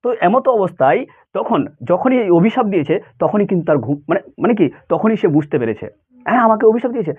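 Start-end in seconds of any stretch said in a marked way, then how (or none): none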